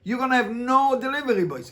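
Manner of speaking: high, loud rhetorical outburst; worked-up teacher; exclaiming. Real, not acted. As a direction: throw the question out high and loud.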